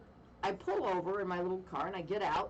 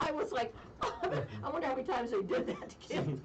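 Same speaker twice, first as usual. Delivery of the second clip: laughing; 6 semitones higher than usual. Real, not acted. Laughing while retelling.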